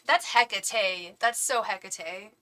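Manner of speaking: Hecate drawn out slowly as HEK-uh-tay; slowly mock the mistake, Hecate as HEK-uh-tay; stress on first syllable. Shown as a demonstration. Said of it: "emphasis on the wrong syllable".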